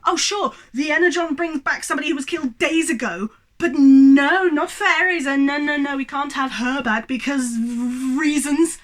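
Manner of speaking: mocking, exasperated rant; sarcastic indignation. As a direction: pour scorn on it, punching the objections. Sarcastic rant at plot's unfairness.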